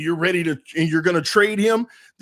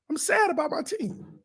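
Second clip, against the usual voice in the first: sad; 8 semitones higher, 6 dB quieter than usual. Real, not acted. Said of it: "I felt emotional".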